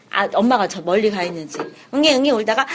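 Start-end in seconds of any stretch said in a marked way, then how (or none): none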